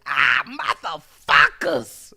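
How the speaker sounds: long, loud, slow groaned curse; drawn-out curse. Retold or imitated. Fuming after his car stalls on the highway, groaning a curse. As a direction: drag the curse out, furious. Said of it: "I'm like".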